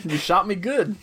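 Micah impression. Co-host joining in on the Micah bit, mock stunned. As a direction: play the stunned gunman who was just hit. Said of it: "I could do a good Micah impression".

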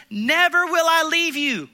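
Never punched loud and high, held slow; emphatic never. Acted. Preacher giving God's promise, Never said loud and slow. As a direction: hammer the word Never. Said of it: "word never is so emphatic".

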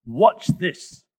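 bold, loud Hippo voice; loud challenge. Acted. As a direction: announce a show of strength loudly.

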